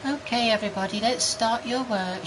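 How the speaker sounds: high-pitched art teacher impression. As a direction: chirp it like a cheery art teacher. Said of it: "she was like".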